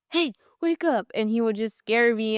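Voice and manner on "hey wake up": much higher mock wake-up call; imitating dad waking her. Daughter imitating dad waking her in the truck. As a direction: play dad rousing a sleepy kid. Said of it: "and he would be like".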